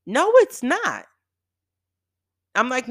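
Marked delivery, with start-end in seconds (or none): 0.1-1.0 high, loud retold contradiction; indignant denial. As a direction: fire back a heated objection, pitch leaping up. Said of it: "And I got mad".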